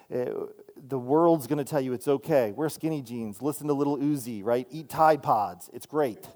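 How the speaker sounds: normal talking voice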